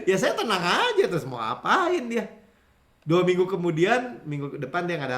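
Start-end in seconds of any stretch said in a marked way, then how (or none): 0.0-2.2 talking through laughter, lifted pitch at the start; laughing. Real, not acted. Preacher telling how he stayed calm under threat, laughing. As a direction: laugh your way through the words.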